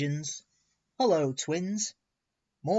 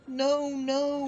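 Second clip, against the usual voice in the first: high, drawn-out wail; 12 semitones higher, 3 dB louder than usual. A narrator's reading. Empty trucks begging the loaded trucks to stop, wailing. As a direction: wail it in dismay. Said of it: "wailed the empty trucks".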